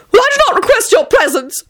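very high, petulant wail; high-pitched flustered objection. A narrator's reading. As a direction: wail in theatrical protest. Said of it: "Tony wailed".

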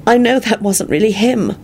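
high, fierce insistence in a woman's voice. A narrator's reading. Woman grieving to the man comforting her, fierce. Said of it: "Elizabeth said fiercely".

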